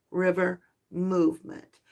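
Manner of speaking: ordinary delivery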